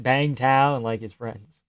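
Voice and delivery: ordinary talk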